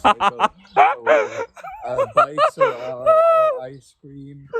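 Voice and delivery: sped-up cartoon voice effect, slow and squeaky; chipmunk voice.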